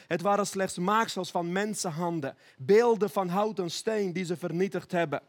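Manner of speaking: ordinary delivery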